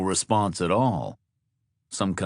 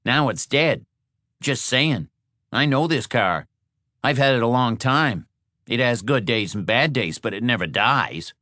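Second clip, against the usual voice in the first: higher-pitched, insistent car owner; higher voice, protesting; defensive Shorty; 5 semitones higher, 3 dB louder than usual. Acted. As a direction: stand up for your car, pitched up.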